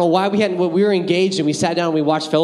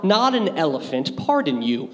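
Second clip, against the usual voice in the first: ordinary delivery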